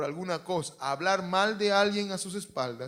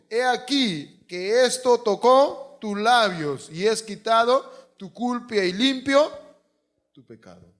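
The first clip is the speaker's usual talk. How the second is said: loud, high and very slow proclamation; forceful preaching; 6 semitones higher, 13 dB louder, slower than usual. Acted. Preacher proclaiming the cleansing of sin, loud and slow. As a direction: drag each word out for the church.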